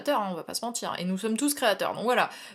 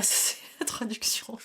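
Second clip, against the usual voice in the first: whispered through laughter; real giggly whisper; laughing whisper; 7 dB quieter than usual. Real, not acted. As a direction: giggle it out under your breath.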